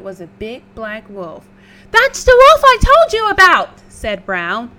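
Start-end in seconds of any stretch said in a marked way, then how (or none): 1.9-3.8 very high, loud squeal of panic; panicked pig. Acted. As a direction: shriek it in a fright. Brown panicking.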